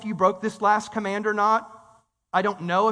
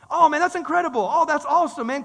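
louder, higher cheer for a friend; excited celebrating; 4 semitones higher, 4 dB louder than usual. Shown as a demonstration. Preacher showing the congregation how to celebrate others, excited. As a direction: gush like someone just got blessed. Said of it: "which is this".

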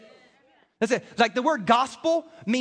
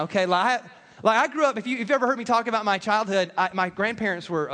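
ordinary talk, nothing marked

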